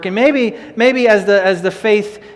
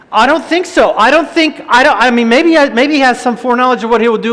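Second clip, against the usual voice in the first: full-force preaching; 6 semitones higher, 9 dB louder than usual. Acted. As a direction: drive the command home to the church. Preaching burst of denial.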